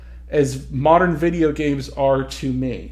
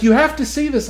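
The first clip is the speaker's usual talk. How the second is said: put-on high and loud eager kid; excited kid; 10 semitones higher, 6 dB louder, faster than usual. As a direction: pester excitedly like a child. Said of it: "He's like".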